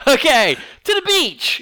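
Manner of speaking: slow, high, loud cheer; hammed-up happy exclamation; mock carefree glee. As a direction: whoop it like heading off on holiday.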